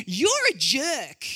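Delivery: a hint of accusing teen friends; friends telling me off. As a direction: play the indignant friends.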